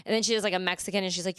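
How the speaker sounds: ordinary delivery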